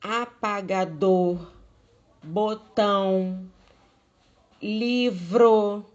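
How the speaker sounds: apagador, botão, livro loud and slow, last syllable hammered; exaggerated final stress. Shown as a demonstration. Teacher listing oxítona examples, apagador, botão, livro loudly end-stressed. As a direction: stretch apagador, botão, livro out loudly, pushing each ending. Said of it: "que é a sílaba forte".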